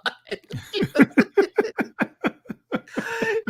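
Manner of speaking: high, sustained cackle of real laughter; long high laugh. Real, not acted. Recalling the startled Rod scene, long high laugh.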